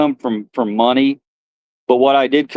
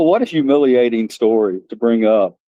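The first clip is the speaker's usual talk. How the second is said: ordinary talk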